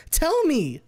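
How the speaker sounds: high, loud demand; angry shout. Acted. Fang yells at her friend to tell her, high and loud. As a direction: shout it at full force. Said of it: "She's angry again".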